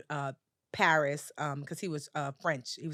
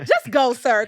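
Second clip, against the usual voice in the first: much louder, fed-up plea; exasperated; 6 semitones higher, 13 dB louder, slower than usual. Retold or imitated. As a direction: push him away with the words. Wishing he'd just vanish.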